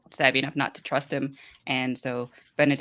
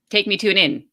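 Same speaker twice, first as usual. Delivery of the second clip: raised, louder quoted demand; 6 semitones higher, 6 dB louder than usual. Retold or imitated. Character orders a street kid to lead, demanding. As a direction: order it briskly, higher and louder.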